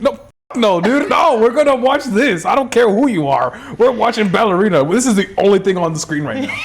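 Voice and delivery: high-pitched mock-stern insistence; exasperated refusal. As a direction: lay down the law to a kid, playfully. Uncle overrules pleading nephew.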